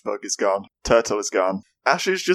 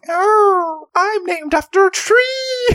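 much higher, slow, cartoonish put-on voice; silly high character voice; 3 dB louder, slower than usual. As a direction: squeak it out like a goofy old professor. Playing Professor Oak, silly voice.

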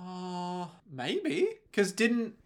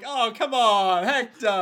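raised, fed-up oh come on; exasperated; 7 semitones higher, 3 dB louder, slower than usual. Retold or imitated. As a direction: groan it, exasperated. Exasperated groan at the show.

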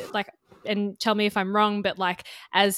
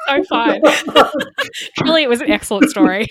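loud, higher, laughing all through; laughing reassurance; 6 semitones higher, 11 dB louder, much faster than usual. Real, not acted. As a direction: talk through real laughter, much louder. Laughing at the awful example.

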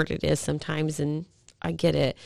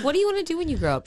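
quick bright question; 11 semitones higher, much faster than usual. Retold or imitated. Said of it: "I'm like".

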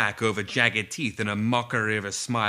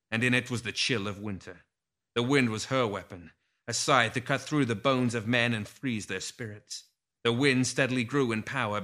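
ordinary delivery

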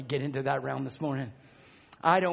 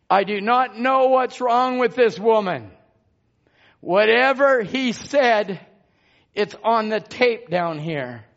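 loud, emphatic proclamation; forceful preaching; 6 semitones higher, 9 dB louder, slower than usual. Real, not acted. Preacher vouches for what was said on the tape, loud and emphatic. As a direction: declare it to the whole congregation.

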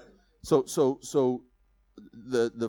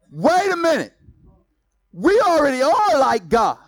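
loud, higher firm rebuttal; bold retort; 11 semitones higher, 8 dB louder than usual. Pretend. What Adam and Eve should tell Satan, loudly defiant. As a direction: push back at the devil.

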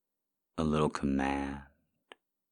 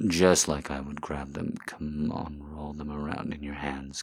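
ordinary talk

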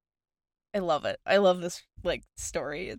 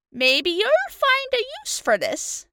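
put-on much higher, creaky old woman; old lady voice; 3 dB louder than usual. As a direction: play a sly old woman, pitched way up. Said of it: "I get to pull out my old lady voice".

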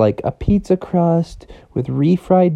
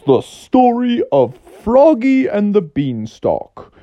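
story intro; 6 semitones higher than usual. A narrator's reading. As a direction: announce the title to young listeners. Opens the read-along story.

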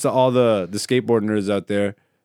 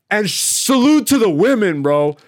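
hype shout-out; 8 semitones higher, 5 dB louder, slower than usual. Real, not acted. Shout-out to skaters.

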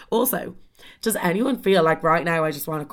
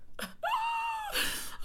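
rueful laugh; 13 dB quieter than usual. Real, not acted. Laughs at the story's turn.

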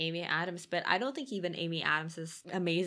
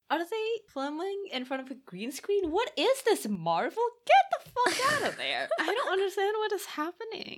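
much higher, rising disbelief; incredulous rant; 13 semitones higher, 4 dB louder than usual. Real, not acted. Podcaster ranting at a film scene, incredulous. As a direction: climb higher and louder with outrage.

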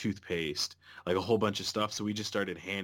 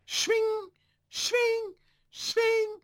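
much higher, louder whooshing swing sounds; knife swish noise; 6 dB louder, much slower than usual. Retold or imitated. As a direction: mimic a blade chopping through the air. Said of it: "like a fucking knife hitting something".

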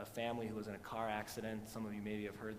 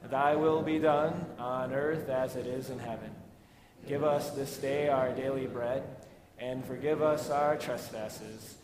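louder, measured liturgical recitation; recited Lord's Prayer; 4 semitones higher, 9 dB louder than usual. Acted. Pastor leading the congregation in the Lord's Prayer, liturgical recitation. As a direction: recite the prayer steadily with the church.

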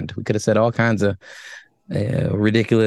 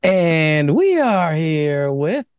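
drawn-out show intro; 5 semitones higher, much slower than usual. Acted. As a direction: drag every word like a show opener. Show intro hosts sequence.

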